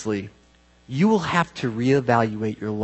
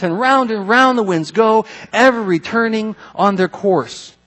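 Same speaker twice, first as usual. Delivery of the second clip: higher, projected reading voice in rolling phrases; declaimed scripture reading; 5 semitones higher, 4 dB louder, slower than usual. Acted. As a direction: proclaim the verses in measured cadence. Reads verses aloud from scripture.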